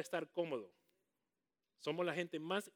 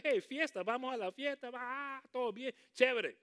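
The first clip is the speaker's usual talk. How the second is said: a lightly voiced, higher, louder partier; party-goer voice; 7 semitones higher, 6 dB louder than usual. Preacher imitating a churchgoer who only joins for fun, mock party voice. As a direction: mimic someone happy to join the party. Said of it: "Es bien fácil gozarme con alguien".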